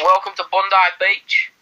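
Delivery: broad mock Australian, lifting at the end; Australian accent. Welcoming visitors to Bondi, mock Australian accent. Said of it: "Australian accents".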